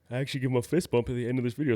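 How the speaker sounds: talking through a yawn. Real, not acted. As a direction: yawn while speaking. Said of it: "Sorry, I'm yawning".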